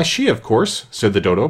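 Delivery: plain reading voice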